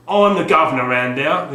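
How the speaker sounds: loud, hard man quote; Ray Winston impression. Quoting the film hard man from the game box, loud. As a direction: growl it like a prison hard man. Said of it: "quoting, uh, Ray Winston in Scum".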